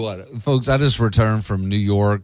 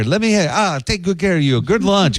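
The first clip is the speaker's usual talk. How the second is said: put-on higher, louder pushy Little Italy hawker accent; New York Italian accent; 9 semitones higher, 3 dB louder than usual. A Little Italy sidewalk hawker pulls in passersby, higher and pushy in a New York Italian accent. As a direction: sell lunch hard in a thick New York Italian accent. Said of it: "Guys, strong accents".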